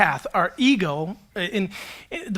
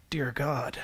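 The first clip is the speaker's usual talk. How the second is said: much softer, lower, awed; stunned murmur; 7 semitones lower, 11 dB quieter than usual. Retold or imitated. Father learning of twins, stunned and very soft. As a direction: breathe it out in shock. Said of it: "you hear me going".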